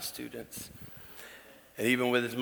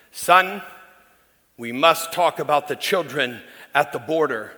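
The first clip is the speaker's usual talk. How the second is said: imitating dad; 3 semitones higher, 6 dB louder than usual. Pastor imitating his father pleading about border children, loud. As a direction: urge it like a visionary old preacher. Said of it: "he would say".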